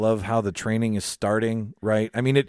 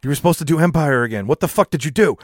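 louder, higher, mock scolding rant; 5 semitones higher, 5 dB louder than usual. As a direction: scold the director playfully. Scolding Rian Johnson.

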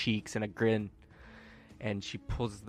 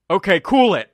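firm order; 10 semitones higher, 14 dB louder than usual. Acted. Tori calms the scene.

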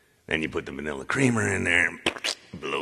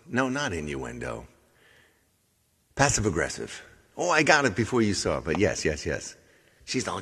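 ordinary talk, nothing marked

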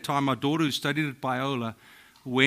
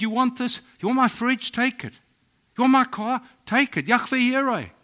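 much higher, excited offering; eager giving; 9 semitones higher, 4 dB louder than usual. Retold or imitated. Church members offer fridge and car, higher and excited. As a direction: offer everything away with glee. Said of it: "They said".

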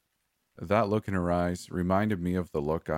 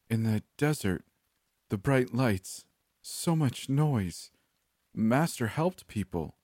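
higher, drifting and halting; a traumatized woman piecing together her past, lightly voiced; dazed broken woman; 7 semitones higher, slower than usual. A narrator's reading. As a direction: sound lost in a hazy memory.

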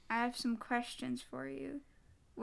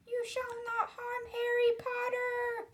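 shrill, very high elf cry; Dobby; 12 semitones higher, 5 dB louder than usual. Acted. Elf shouts to protect Harry Potter from his master, shrilly. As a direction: squeal it defiantly as a small elf. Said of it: "said the elf shrilly".

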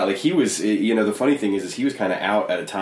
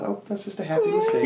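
high, soft, gentle reassurance; gentle Bob Ross; 4 dB quieter than usual. Retold or imitated. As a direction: coo it like a kindly painter. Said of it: "he's just so nice about".

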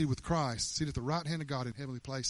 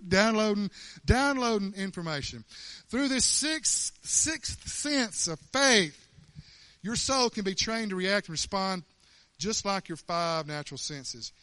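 ordinary delivery

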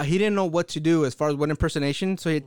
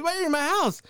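mock annoyed complaint; 8 semitones higher than usual. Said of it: "He was".

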